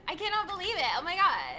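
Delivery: put-on high, breathless Valley Girl gush. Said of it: "Can you do Valley Girl".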